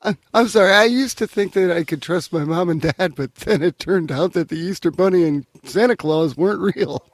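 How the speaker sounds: put-on high, pleading little-kid voice; hammed-up childlike dismay at learning the truth; mock distraught child. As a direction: play the heartbroken child who just lost faith in his parents. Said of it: "It's very convincing".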